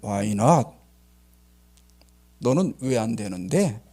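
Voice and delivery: lightly voiced low, measured God; God answering. God replying to the protesting captain, calm low challenge. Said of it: "하나님께서 뭐라고 답변해요".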